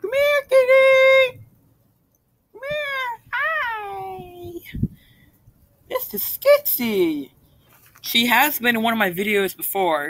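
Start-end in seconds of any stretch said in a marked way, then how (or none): 0.0-3.2 high sing-song call to a cat. Real, not acted. Calling the cat. 3.3-7.3 cat-talk voice. Real, not acted. Introducing the cat to viewers.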